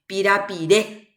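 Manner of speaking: "Pirapiré" with the weight on the last É; teacher demonstrating end-stressed "Pirapiré"; stress on final vowel. Shown as a demonstration. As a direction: land firmly on the final É of "Pirapiré". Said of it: "siempre la fuerza de pronunciación".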